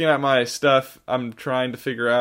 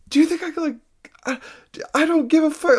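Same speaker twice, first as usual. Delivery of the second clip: retold screaming; faster than usual. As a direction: imitate a distressed stranger screaming at you, much higher. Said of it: "like, screaming at me".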